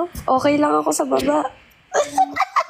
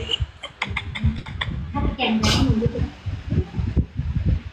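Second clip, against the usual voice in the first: long soft airy laugh; breathy laugh; 5 dB quieter than usual. Real, not acted. Laughing at own story.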